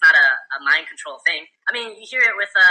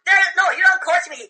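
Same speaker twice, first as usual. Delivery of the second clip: incredulous quote; 8 semitones higher than usual. Retold or imitated. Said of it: "We're like".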